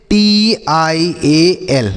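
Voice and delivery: letters drawn out one by one; very slow, letter-by-letter spelling. Shown as a demonstration. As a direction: spell it very slowly for pupils.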